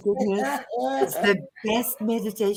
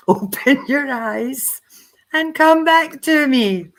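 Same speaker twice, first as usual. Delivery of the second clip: laughing; 7 semitones higher, 7 dB louder, slower than usual. Real, not acted. Ends meditation, bursting into laughter.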